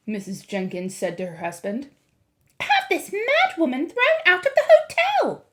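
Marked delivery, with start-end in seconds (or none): 2.6-5.5 very high, loud indignation; shrieky, affronted wife; outraged mother. A narrator's reading. Mrs. Jenkins complains of the grandmother, high and outraged.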